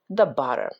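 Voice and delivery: ordinary delivery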